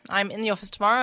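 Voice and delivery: ordinary talk, nothing marked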